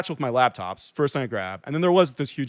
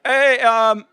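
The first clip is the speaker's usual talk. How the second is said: higher, louder call that stalls on "um"; retold hesitant call-out; 9 semitones higher, 6 dB louder than usual. Victim calling to kids pulling out, hesitant retold call-out. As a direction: imitate calling after someone, then falter. Calls after departing car, retold.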